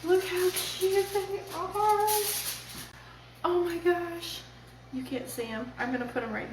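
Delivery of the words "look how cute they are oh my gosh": gushing. Real, not acted. Gushing over tiny burgers.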